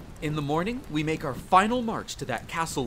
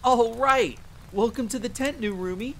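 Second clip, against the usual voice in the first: high, loud burst of delight easing slightly; excited welcome; 6 semitones higher, 3 dB louder than usual. Acted. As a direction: greet your new tentmate with a cheer. Welcomes the new tentmate.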